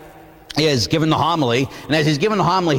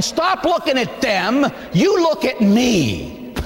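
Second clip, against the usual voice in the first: slow, higher, commanding divine rebuke; voice of God; 7 semitones higher, slower than usual. Acted. God rebuking judgmental churchgoers, slow stern command. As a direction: speak as God calling the people back. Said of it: "God's saying".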